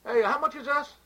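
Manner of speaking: high, loud demanding customer. Acted. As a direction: shout the price question up high. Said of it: "You are the customer".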